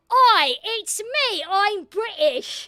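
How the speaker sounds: loud, drawn-out mock British accent; bad British accent. Auditioner badly faking a British accent, hammed-up and loud. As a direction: put on an overblown, slow fake British accent. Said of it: "into the audition like".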